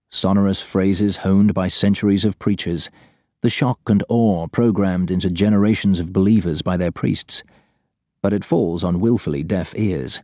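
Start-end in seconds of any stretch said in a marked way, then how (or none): none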